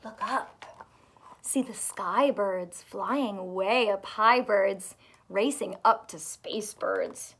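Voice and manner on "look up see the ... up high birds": ordinary delivery, nothing marked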